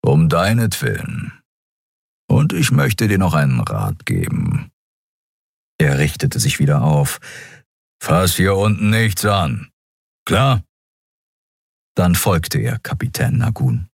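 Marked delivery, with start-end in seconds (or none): 0.0-4.7 restrained creature growl; grumbling beast-man. A narrator's reading. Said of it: "brummte er". 8.0-10.6 slow, deliberate creature voice; menacing big-cat figure; stern beast-man warning. A narrator's reading. Big cat creature warns the boy.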